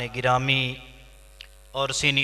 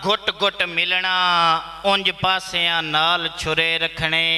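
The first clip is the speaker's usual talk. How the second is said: higher-pitched, declaimed mushaira verse; poetry recital; 8 semitones higher, slower than usual. Acted. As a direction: declaim each line to the audience, pausing between phrases. (मुशायरे विच उच्ची सुर नाल शेर)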